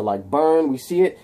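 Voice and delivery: usual speaking voice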